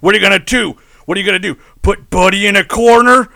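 loud taunting challenge; shouting match. Pretend. Acted bar quarrel, taunting.

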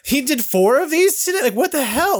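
high-pitched, incredulous outburst; mock amazement at someone's output; astonished onlooker.

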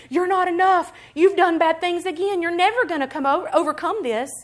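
loud, high-pitched accusing rant; screaming accusation. Acted. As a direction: hammer out the accusations like an inner tormentor. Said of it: "your soul is screaming at you".

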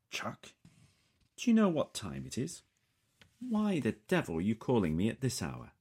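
high, indignant British man woken up; sleepy British voice. A narrator's reading. Woken at a late hour by a call, sleepy British annoyance. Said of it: "I hear a sleepy voice saying".

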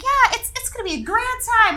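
put-on old-fashioned pioneer. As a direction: sound like Little House on the Prairie. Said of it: "from Little House on the Prairie".

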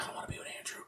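barely audible imitated kid; reluctant whisper. Kid refusing to pair with Andrew, whispering. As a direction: whisper the refusal under your breath.

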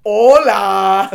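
"Hola!" shouted high and loud, laughing; South American version. Acted. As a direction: Latin accent, called out as a reproach. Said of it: "This is a South American version".

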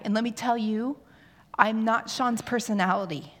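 ordinary talk

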